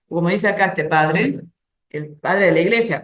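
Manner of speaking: ordinary delivery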